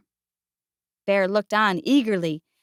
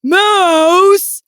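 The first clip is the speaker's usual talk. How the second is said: loud, higher exasperated call; 6 semitones higher, 5 dB louder than usual. A narrator's reading. Bear finds Mouse missing again, loud call. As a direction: holler for the culprit.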